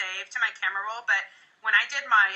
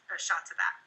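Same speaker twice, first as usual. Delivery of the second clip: ordinary talk, nothing marked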